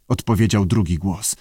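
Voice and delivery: usual speaking voice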